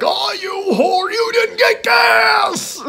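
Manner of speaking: high, slow mock shout of an old man; imitating Hal yelling. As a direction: squawk it like a panicked old man yelling at his wife. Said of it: "Hal's always yelling".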